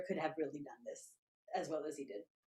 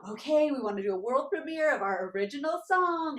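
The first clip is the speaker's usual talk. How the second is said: loud, higher stage announcement; excited announcement; 5 semitones higher, 5 dB louder than usual. Retold or imitated. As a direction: proudly announce it to a club audience. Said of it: "so excited to announce it".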